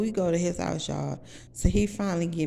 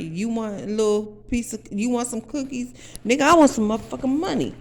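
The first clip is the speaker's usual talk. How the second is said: demanding; 6 semitones higher, 5 dB louder than usual. Retold or imitated. Demanding payment, heated.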